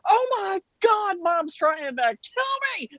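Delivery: high, drawn-out sister's wail; imitating a hysterical sister, stretched and squealing; mock panicked shriek. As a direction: shriek it as a hammed-up cry for rescue. Acts out sister crying for help.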